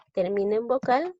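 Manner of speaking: normal talking voice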